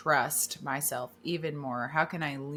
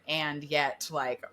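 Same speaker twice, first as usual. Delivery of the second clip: usual speaking voice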